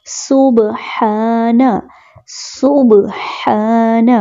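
سبحان drawn out slowly, breathy ha from the chest; سبحان chanted slowly with a clear ha; careful ha sound. Shown as a demonstration. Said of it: "ہا کی آواز کو ٹھیک پڑھنا ہے".

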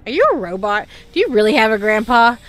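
loud, disbelieving questions to the screen; incredulous outburst. Real, not acted. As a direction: call out the game character in disbelief. Loud disbelief at game character.

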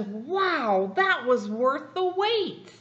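delighted wow. Acted. Penguin delighted, slow.

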